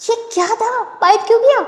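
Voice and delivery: high, indignant ouch-why; surprised protest. Acted. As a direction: yelp at the bite. Bitten, surprised.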